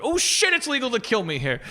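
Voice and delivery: high-pitched panicked realization, played for laughs; comic jolt of alarm; alarmed pedestrian.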